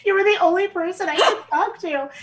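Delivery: high, melodramatic mock lament; telenovela sobbing. Daughter eulogizing her gerbil, hammed-up telenovela sobbing. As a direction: ham up a soap-opera grief. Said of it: "crying like a Spanish telenovela".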